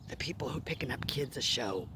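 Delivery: hushed whisper. Real, not acted. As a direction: whisper so nobody nearby hears. Hiding from parents nearby.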